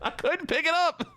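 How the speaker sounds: very high strained whine; squealed excuse after a fumble; exasperated squeal. Real, not acted. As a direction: wail it high in comic dismay. Failed to grab dropped dynamite.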